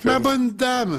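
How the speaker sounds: way up high, like a dainty lady; mistakenly playing the lady, falsetto. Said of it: "Change de voix".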